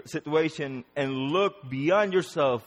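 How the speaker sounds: ordinary delivery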